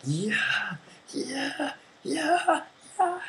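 very high squeaky celebration; mock cheering. Opposing team celebrating a thrown-in goal, mock squealed cheers. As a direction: imitate over-the-top cheers in falsetto. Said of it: "cheering so hard".

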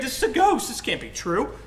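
high, loud alarmed cry; hammed-up shock; acted startled fright. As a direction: blurt it in a high, spooked voice. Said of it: "they were all startled and frightened".

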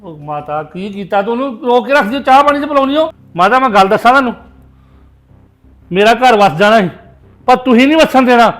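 loud, low-pitched outburst; angry reproach. Acted. Son blaming mother for his lover leaving, angry reproach. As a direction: blast her with furious blame.

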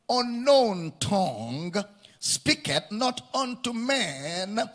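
slow, drawn-out Bible reading; scripture reading. Acted. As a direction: read the verse out slowly to the congregation. Reads a Bible verse aloud.

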